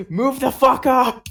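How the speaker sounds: put-on loud, high comic German accent yelling; thick German accent. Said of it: "in the thickest German accent".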